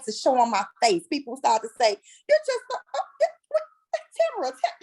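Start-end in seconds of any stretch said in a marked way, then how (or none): 2.3-4.8 high, halting mock astonishment; imitated amazement. People noticing her new brightness, imitated in high, stammering amazement. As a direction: stammer it high, as if lost for words.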